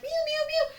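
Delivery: very high squeaky meowing; high-pitched mock meow; kitten meows. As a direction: imitate tiny kittens mewing. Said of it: "making these little sounds like".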